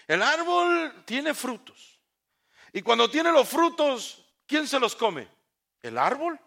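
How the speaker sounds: high, loud pulpit preaching; fervent sermon delivery; preaching at full force. Acted. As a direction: preach it out to the congregation. Preacher builds the tree image.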